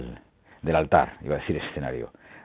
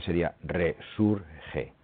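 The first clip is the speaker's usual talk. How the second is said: 1 slow reveal; 7 dB quieter, much slower than usual. Shown as a demonstration. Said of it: "giving the riddle's answer slowly".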